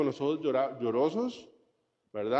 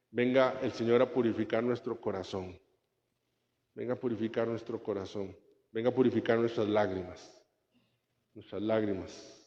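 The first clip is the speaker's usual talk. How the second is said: soft prayer; 3 semitones lower, 4 dB quieter than usual. Acted. Closing prayer.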